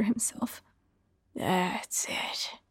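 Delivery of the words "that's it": low, soft breathy moan from the killer. A narrator's reading. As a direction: let it out as a low, hushed moan. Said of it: "he moaned".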